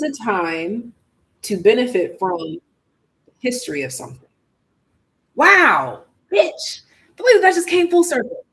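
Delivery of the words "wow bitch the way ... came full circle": excited. Real, not acted. Card message clicks for reader.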